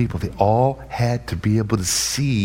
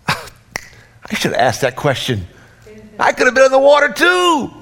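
lightly voiced disciple, rueful then high; mock regret from the boat; a hint of a jealous disciple; imitating envious disciple; 13 semitones higher, 4 dB louder than usual.

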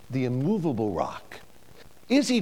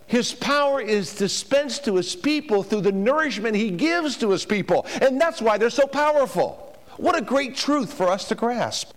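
higher and louder, soaring preacher's cry; preaching at full force; 9 semitones higher, 3 dB louder than usual. Acted. Preacher exulting to the congregation over God's nourishing power, full force. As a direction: lift the hall with it.

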